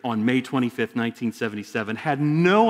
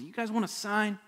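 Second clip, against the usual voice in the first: quiet challenge; 7 semitones higher, 9 dB quieter than usual. Retold or imitated. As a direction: ask it softly, almost under the breath. Jesus answers temple authorities.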